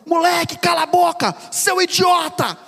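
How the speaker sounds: angry mother yelling. Retold or imitated. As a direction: scream at the kid like an enraged mother. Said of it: "se a minha mãe tivesse falado assim". (mãe gritando furiosa com o filho)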